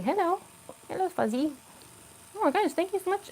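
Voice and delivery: soft, high hello to the pet; cooing to a cat. Real, not acted. Greeting the cat at her leg.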